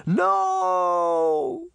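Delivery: high, loud cry of despair; anguished cry. A narrator's reading. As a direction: scream it as they vanish. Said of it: "Sabrina cried".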